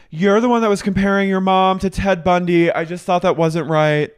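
high, loud retold accusation; mock accusation. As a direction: hurl the blame, hammed up.

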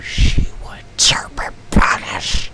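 hushed, slow spooky whisper; creepy whisper. Acted. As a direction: whisper it like a ghost story. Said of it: "that's my creepy voice".

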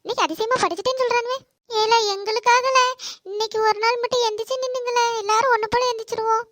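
usual speaking voice

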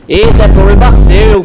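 loud, brushing it off; loud retort. Real, not acted. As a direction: yell it dismissively. Shouting at a pursuer.

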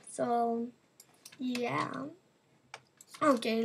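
normal talking voice

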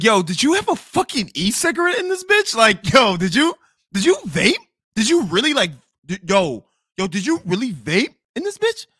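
high, loud, furious accusation; incredulous outrage. Retold or imitated. Confronting his date about vaping, high outraged yell. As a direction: blast the question at her in disbelief.

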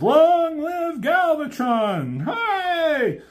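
high shouted cheer of the Decepticons; high, slow cartoon chant; crowd cheer. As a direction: cheer it like a mob hailing its new leader. Decepticons cheering their new leader.

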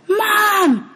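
put-on a boy's terrified, high-pitched scream; anguished scream. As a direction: scream for your mother as she's grabbed. Son sees mother seized.

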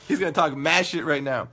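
ordinary talk

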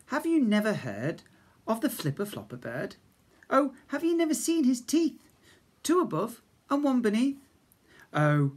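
ordinary delivery

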